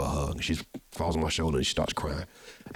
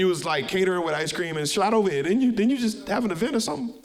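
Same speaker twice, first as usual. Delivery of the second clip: much higher, louder excited ribbing; excited high teasing; 14 semitones higher, 4 dB louder than usual. Real, not acted. Host teasing the guest who rushed over from a wedding, excited and high. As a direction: tease him, voice up high and lively.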